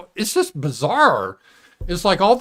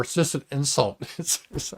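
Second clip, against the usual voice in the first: usual speaking voice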